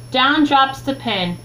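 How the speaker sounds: plain reading voice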